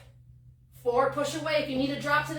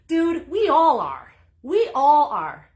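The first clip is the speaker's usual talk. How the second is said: emphatic; 3 semitones higher, 9 dB louder, slower than usual. Real, not acted. Emphatic motivational pep talk.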